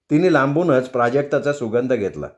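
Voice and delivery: plain reading voice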